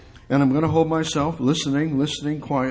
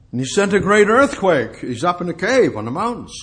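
loud, high burst of drama; dramatic storytelling; 4 semitones higher, 6 dB louder than usual. Retold or imitated. Preacher telling of Elijah's earthquake, loud and dramatic. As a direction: thunder out the earthquake.

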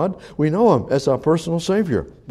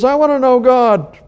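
much higher, louder eager cry; eager seeker; 10 semitones higher, 5 dB louder than usual. Pretend. Seeker in the congregation calling out eagerly to God. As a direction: call it out like a hungry seeker. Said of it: "Someone else says".

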